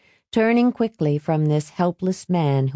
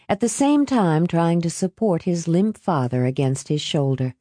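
ordinary delivery, nothing marked

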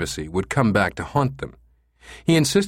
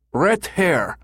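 much higher German tourist voice; thick German accent; 9 semitones higher than usual. A narrator's reading. Caller repeating himself, feeling stupid, thick German accent. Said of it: "with a thick German accent".

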